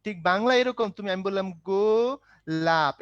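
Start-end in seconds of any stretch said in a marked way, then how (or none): 1.5-3.0 "গোলাপ" drawn out slowly as গো, লাপ; slow two-beat "গোলাপ" for counting syllables; split into syllables. Shown as a demonstration. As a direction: stretch each syllable of "গোলাপ" apart. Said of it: "এখানে কয়টা syllable ভাইয়া".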